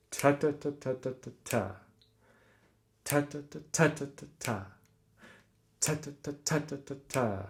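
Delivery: "ta, ta, ta" in threes, first "ta" accented; rhythmic accents. Shown as a demonstration. Teacher sounding out two groups of three, rhythmic accents. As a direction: chant "ta" softly, leaning on each group's first. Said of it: "two groups of three to make up the group".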